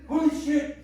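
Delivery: loud, high yelp of surprise; shocked exclamation. Retold or imitated. Spider expert shouting at the tiny spider, loud and high. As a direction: blurt it out, stunned. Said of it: "in his Hungarian accent".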